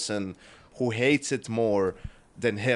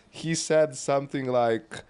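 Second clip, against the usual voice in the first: ordinary delivery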